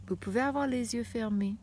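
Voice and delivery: usual speaking voice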